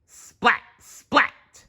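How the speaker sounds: high loud splat sounds; splat noise. Acted. Narrator voicing thrown vegetables, loud splats. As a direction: make the splats punchy.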